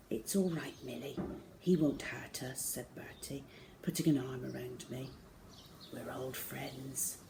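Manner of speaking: soft, gentle reassurance; calm her softly; reassuring. Acted. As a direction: Bertie soothing Millie softly. Bertie calms frightened Millie.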